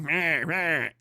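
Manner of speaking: a silly dismissive mumble; mocking sidekick. Mocking the other comedian in the corner with a soft silly bleat. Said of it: "in the other corner going".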